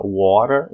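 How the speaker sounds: first "water" said "wa-rer"; t as tapped r. Shown as a demonstration. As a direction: say "water" with a light tap for the t. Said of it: "o T tem som do R".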